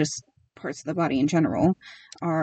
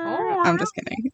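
high, playful kidding; 12 semitones higher than usual. Real, not acted. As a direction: toss it off in a high voice.

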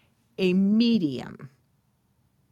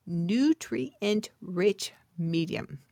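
normal talking voice

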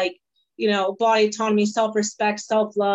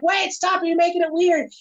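playful protest; 7 semitones higher than usual. Retold or imitated. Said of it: "And I'm like".

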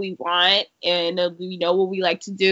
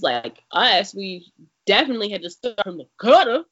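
normal talking voice

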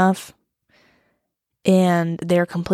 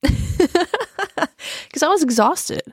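laughing; 10 semitones higher, much slower than usual. Real, not acted. Laughs while explaining nap.

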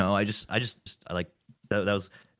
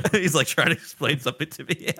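laughing; 8 semitones higher, 3 dB louder than usual. Real, not acted. Laughing at own story.

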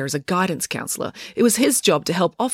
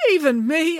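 much higher, innocent; 10 semitones higher than usual. Acted. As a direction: feign innocence. Tom feigning innocence.

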